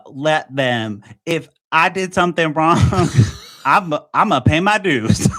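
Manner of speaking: put-on a weak, half-hearted stab at a Boston accent; bad Boston accent. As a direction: try for Boston and barely get there, laughing through it. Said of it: "I'm gonna try to do my Boston accent here".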